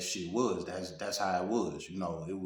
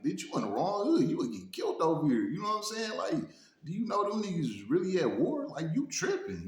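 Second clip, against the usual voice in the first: put-on high, stern warning to the girl; retold harsh scolding; 10 semitones higher than usual. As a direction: set her straight, hammed-up anger. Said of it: "he instantly checked her".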